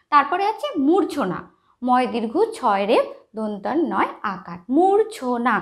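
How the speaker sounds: normal talking voice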